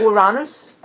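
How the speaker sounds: "Uranus" as oo-RAH-nus; Latin-style "Uranus" with an open AH; Latin pronunciation. Shown as a demonstration. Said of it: "if it's Latin".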